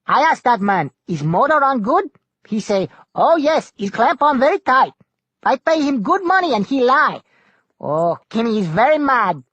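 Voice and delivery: loud, high pidgin rant about the liar; Kimmy the navigator. A narrator's reading. Kimmy complaining to Tuck about the motor man, loud and high. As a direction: huff it out in broken English, indignant.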